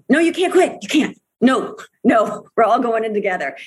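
rallying a friend who wants to give up; urgent pep talk. Retold or imitated. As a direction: refuse to let a teammate quit. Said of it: "one of the five of us said".

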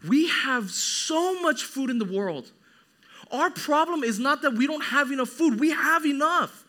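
high, loud, drawn-out emphatic sermon; forceful preaching. Acted. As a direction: hammer the point home to the congregation.

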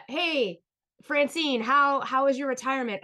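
high, loud, bright small-talk voice; forced cheerful question. Retold or imitated. Asking Francine at the silent table, forced cheer. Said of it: "One word answer".

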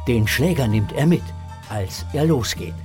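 usual speaking voice

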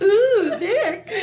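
very high, swooning ooh; dreamy swoon. Retold or imitated. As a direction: swoon over the name like a fan. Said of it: "He's like".

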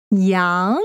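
ordinary delivery

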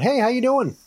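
high friendly call into the dark; cheery call toward the boathouse. Acted. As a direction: hail someone you can't see yet. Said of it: "So I'll call out".

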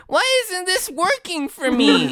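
very high, thin little creature voice; Twiggly's squeaky voice. Acted. Twiggly puzzled by his own sword, very high squeaky voice. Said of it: "and he goes".

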